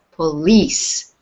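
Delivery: "Police" with LEASE drawn out and stressed; stress on second syllable. Shown as a demonstration. Teacher showing a student where the stress falls, second syllable of "Police" stressed. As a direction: lean hard on the end of "Police". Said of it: "the stress is on lease".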